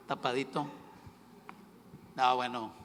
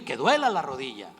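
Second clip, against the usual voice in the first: ordinary talk, nothing marked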